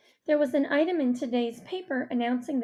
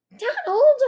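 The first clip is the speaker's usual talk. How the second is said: very high, loud scolding call; shushing rebuke; 9 dB louder than usual. A narrator's reading. Mom trying to shush dad, sharply alarmed. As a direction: cut him off in alarm. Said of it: "My mom tried to shush him".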